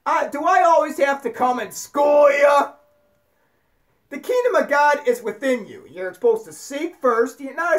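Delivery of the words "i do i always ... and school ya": raised-voice mock exasperation; Jesus scolding. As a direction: scold clueless followers, stretching the words out. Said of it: "He's like".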